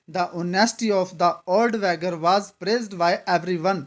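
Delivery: put-on The honesty of the old beggar, read very slowly and evenly; slow model sentence. Shown as a demonstration. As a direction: dictate each word so students catch the. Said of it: "मैं ऐसे बोलता".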